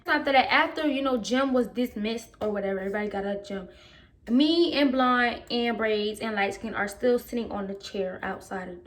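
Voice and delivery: normal talking voice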